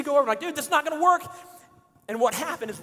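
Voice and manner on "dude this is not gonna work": higher-pitched retold alarm; mock last-second urgency, pitch up; urgent warning. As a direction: blurt a warning to a buddy. Retold warning just before the drop.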